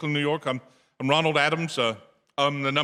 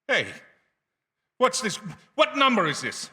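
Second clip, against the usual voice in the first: much higher, desperate questioning; terrified confusion; 10 semitones higher than usual. Acted. Caller hearing of his own death, terrified. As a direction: grasp for sense as panic peaks.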